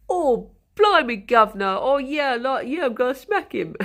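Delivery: hammed-up, over-the-top British wrestler voice; ridiculous British accent. Mocking British wrestlers on American shows, exaggerated British accent. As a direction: lay on a silly, loud British accent with big swoops in pitch. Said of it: "anytime there is someone British, they sound so ridiculous".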